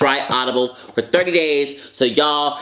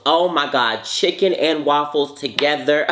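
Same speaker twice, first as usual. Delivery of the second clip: normal talking voice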